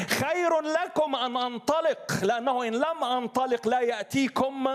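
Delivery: high, ringing pulpit voice quoting scripture; preaching at full force. Acted. Preacher lifting the verses high to his congregation, ringing preaching.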